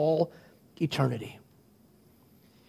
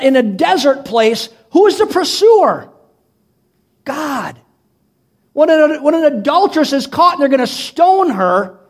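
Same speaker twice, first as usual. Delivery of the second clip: high, emphatic sermon delivery; raised pitch, punchy rhetorical questions; impassioned preaching; 11 semitones higher, 6 dB louder than usual. Real, not acted. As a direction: drive home each question like a preacher. Preaches with mounting force.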